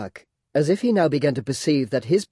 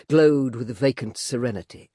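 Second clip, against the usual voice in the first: ordinary delivery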